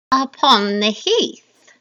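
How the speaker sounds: loud, slow witch voice; eerie drawn-out hag reply; second witch. Acted. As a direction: answer like a crone on the moor. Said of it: "introduce you to the three witches".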